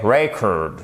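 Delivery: record as the noun, REC-ord, slowly; record with open E and early stress; stress on first syllable. Shown as a demonstration. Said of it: "l'accentuation non pas sur la seconde syllabe".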